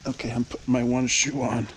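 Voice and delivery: usual speaking voice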